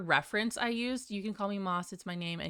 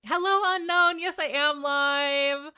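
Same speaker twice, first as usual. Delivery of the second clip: much higher, louder, beaming hello, slowing on the last words; excited greeting; 9 semitones higher, 7 dB louder than usual. Real, not acted. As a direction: burst out delighted at a returning viewer. Greets viewer in chat.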